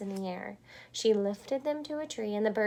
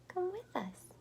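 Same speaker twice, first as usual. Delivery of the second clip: whispered; 10 semitones higher, 8 dB quieter than usual. Acted. Whispered invitation.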